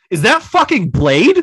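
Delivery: loud excited shout; screamed. Retold or imitated. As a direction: yell it out in the theater. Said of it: "Apparently, I just screamed it".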